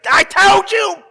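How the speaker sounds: high, loud finger-wagging scold; scolding Jesus. Pretend. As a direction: scold like a stern, fist-shaking judge. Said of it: "Jesus looking at Peter like this, shaking his fist".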